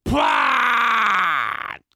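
a long, drawn-out spat "パーン" shout; spitting gunshot noise. Pretend. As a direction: spit out a mock gunshot. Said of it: "唾が目に入った". (唾を飛ばして叫ぶ鉄砲の音)

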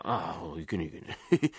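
low, hushed self-reproach; soft "oops" undertone; caught-out muttering. Acted. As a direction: mutter quickly to yourself, not to be heard.

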